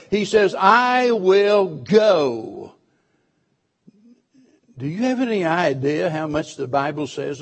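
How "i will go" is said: "I will go" drawn out very slowly, each word weighted; emphatic, slow. Retold or imitated. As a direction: lay down every word of the vow. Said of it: "But notice the next word".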